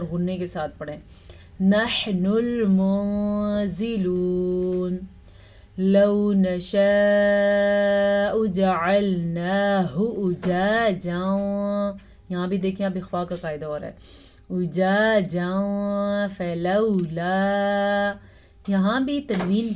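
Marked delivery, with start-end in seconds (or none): 1.6-11.9 slow melodic tajweed chant; measured Quranic recitation. Shown as a demonstration. Recitation demo. 14.6-18.1 slow melodic tajweed chant; measured Quranic recitation. Shown as a demonstration. Recitation demo.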